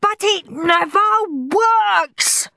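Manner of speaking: high, slow wailing scream; screaming child. A narrator's reading. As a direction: wail it out like a desperate boy. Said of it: "screamed Henry".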